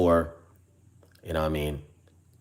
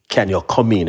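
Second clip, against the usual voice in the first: lightly mimicked receptionist, louder; a louder hint of the receptionist's stock question; mock receptionist, louder; 2 semitones higher, 8 dB louder than usual. Receptionist asking a patient to come in, mimicked louder. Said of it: "this is how they talk".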